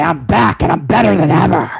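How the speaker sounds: a hint of a gloating tycoon proclaiming his return; Vince McMahon impression. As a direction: announce the comeback in a lightly put-on boss voice. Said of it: "I will give you a little bit of Vince".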